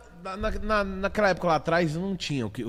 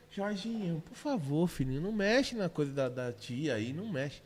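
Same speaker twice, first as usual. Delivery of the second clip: put-on much softer sweet pleading to a child; mock sugary coaxing; 2 semitones lower, 10 dB quieter than usual. Imitating a soft mother begging her son not to touch, much softer and sugary. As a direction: coo at the kid like a soft mother.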